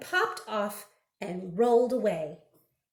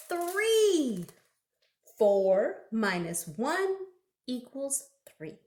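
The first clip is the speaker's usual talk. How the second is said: usual speaking voice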